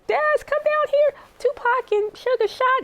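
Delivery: imitating mom yelling up to Daz; mother calling Daz down with terrible news, urgent. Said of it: "And she says".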